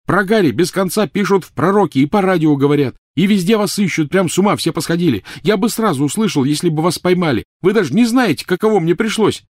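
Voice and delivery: high, loud yell. Acted. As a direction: bellow it back at her. Ron shouting back.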